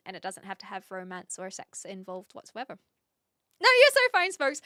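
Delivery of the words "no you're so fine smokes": squeaky bright no; high excited reassurance. Real, not acted. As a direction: pitch it up and gush. Reassuring chat, high burst.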